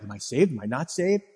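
worried. Retold or imitated. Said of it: "you start to worry".

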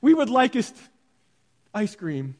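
a hint of a child begging in stilted formal words; lightly hammed-up plea for ice cream; mock formal child plea. Preacher imitating his kids asking for ice cream, mock formal. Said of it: "My kids don't come up to me".

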